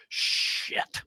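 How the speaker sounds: softly whispered dismay; whispered curse. Retold or imitated. Batter realizing he's out of his depth, whispered curse. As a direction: curse under your breath.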